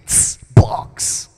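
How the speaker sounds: "tss, box" hissed and popped unvoiced; beatbox "tss, box"; beatbox word. Shown as a demonstration. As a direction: spit it as a rhythm. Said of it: "Now you add the beat".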